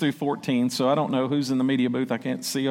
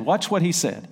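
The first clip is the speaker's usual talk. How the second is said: ordinary talk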